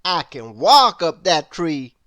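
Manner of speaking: loud proud storybook boast; Mister Impossible boasting. A narrator's reading. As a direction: brag it like nothing is beyond you. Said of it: "replied Mister Impossible".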